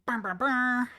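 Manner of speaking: buzzing brr noise; chainsaw buzz. Pretend. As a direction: rev it like a chainsaw. Mock chainsaw after chat insult.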